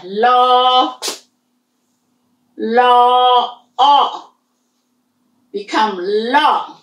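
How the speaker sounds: teacher demo of blending; blending law and o. Shown as a demonstration. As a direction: sound out then join the syllables. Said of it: "put a law in there".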